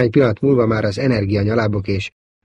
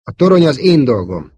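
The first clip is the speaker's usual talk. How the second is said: snapping; 5 semitones higher, 7 dB louder than usual. A narrator's reading. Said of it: "csattant föl Luke".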